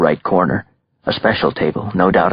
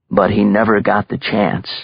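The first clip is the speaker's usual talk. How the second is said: usual speaking voice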